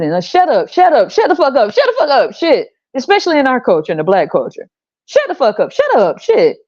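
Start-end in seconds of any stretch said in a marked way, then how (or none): 0.2-2.7 high, sassy mock scolding; mocking shut-up rant. Mocking quoted women telling off. 5.1-6.6 high sassy mock scolding; mocking shut-up rant. Mocking quoted women telling off.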